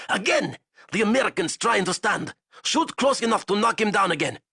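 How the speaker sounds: high, loud cruel orders; commanding shout. Acted. Zayson commanding the gunners over Azov's protests, high loud. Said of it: "he commanded".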